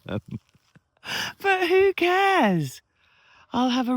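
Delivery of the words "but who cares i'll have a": drawn-out, much higher and louder "who cares"; mock carefree. Real, not acted. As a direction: toss it off with a big theatrical shrug. Shrugging off doubts about no viewers.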